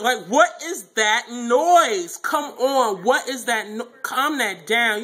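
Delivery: high, loud exasperated outburst. Real, not acted. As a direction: stretch it out in fed-up disbelief. Complaining about Alex's noises.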